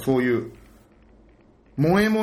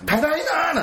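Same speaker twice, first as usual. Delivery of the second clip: high, eager greeting; excited; 13 semitones higher than usual. Retold or imitated. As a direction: call it out all fired up. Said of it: "俺もテンション上がっちゃって". (テンション高く)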